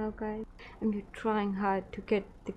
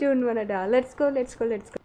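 louder panicked cry to flee; 3 semitones higher, 6 dB louder than usual. Real, not acted. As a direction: shout it out in fear. Fleeing gunfire in panic.

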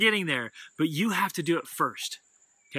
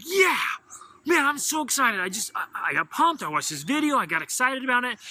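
excited announcement; 6 semitones higher than usual. Pretend. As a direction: share the buzz like you just got pumped. Parent tells kids about goals.

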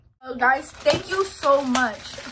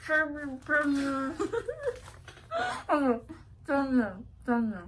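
mouth full; 3 semitones higher, 3 dB quieter than usual. Real, not acted. Mouth stuffed, laughing.

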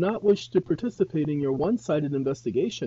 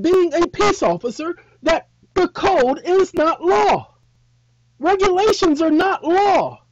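much higher, much louder incredulous outburst; indignant shouting; 10 dB louder than usual. Retold or imitated. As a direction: shout it in disbelief at the officer. Indignant driver lecturing officer.

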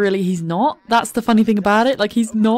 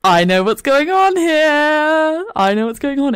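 Carly's knowing voice; 6 semitones higher, 5 dB louder, slower than usual. Pretend. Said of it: "she's so".

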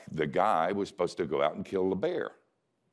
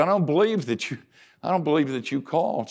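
a touch of the crying wife, higher; mild tearful wife; 7 semitones higher, faster than usual. Retold or imitated. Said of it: "she started crying".

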